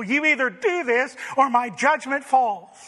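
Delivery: ordinary delivery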